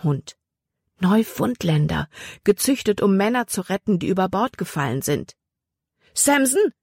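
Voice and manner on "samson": much higher, sharp call to the dog; commanding call. A narrator's reading. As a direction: order the dog to go. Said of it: "befahl Libby". (befehlender Ruf, viel höher)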